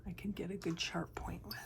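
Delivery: soft unvoiced ASMR whisper. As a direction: whisper it close to the mic. Whispered drawing tutorial.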